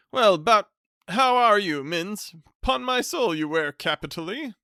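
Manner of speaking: loud, high hearty greeting; top of his voice. Acted. Hearty cousin greeting the bachelor at the top of his voice. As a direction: boom it out like a jovial bore. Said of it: "spoke at the top of his voice".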